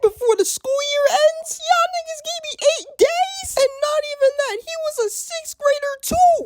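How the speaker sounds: very high, squeaky outraged rant; high-pitched mock indignation; outraged squeal. Ranting about unfair punishment.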